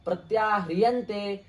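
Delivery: measured, chanted Sanskrit definition; teacher intones the Sanskrit definition; Sanskrit recitation. Acted.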